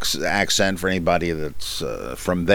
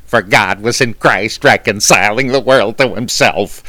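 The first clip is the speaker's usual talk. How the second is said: louder, campy comic voice reading scripture; loud, arch comedian voice on a Bible verse; Paul Lynde impression; 2 semitones higher, 6 dB louder than usual. Shown as a demonstration. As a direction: give the verse a sly campy sitcom twist. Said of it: "my fevered imagination is the Apostle Paul Lynde".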